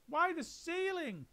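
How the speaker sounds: baffled little monkeys crying out; squeaky astonished monkeys. A narrator's reading. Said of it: "they cried".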